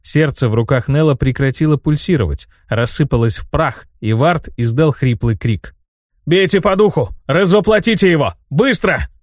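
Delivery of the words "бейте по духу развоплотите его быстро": urgent shout. A narrator's reading. Urgent battle command to strike.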